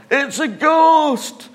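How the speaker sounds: high, loud, slow frightened cry; terrified scream. Retold or imitated. Disciples in the boat screaming at the ghostly figure. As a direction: shriek it at the ghost. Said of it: "started to point and scream".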